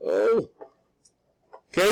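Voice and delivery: ordinary delivery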